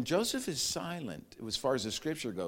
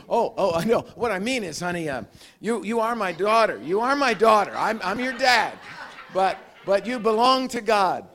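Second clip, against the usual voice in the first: louder, earnest reassurance; reassuring father; 5 semitones higher, 7 dB louder than usual. Retold or imitated. Father reassuring crying little daughter, earnest. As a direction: hurry to comfort her.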